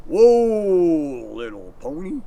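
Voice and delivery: calm a horse the cowboy way; cowboy whoa. Shown as a demonstration. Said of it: "they usually say something like this".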